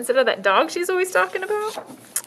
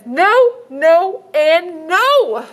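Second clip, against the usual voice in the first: put-on high, slow, firm little girl; 7 semitones higher, 7 dB louder, much slower than usual. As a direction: insist like a stubborn child. Girl refusing loudly.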